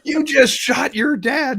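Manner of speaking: high, slow, stunned exclamation; incredulous cry. Retold or imitated. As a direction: cry out in disbelief. Telling son he shot dad.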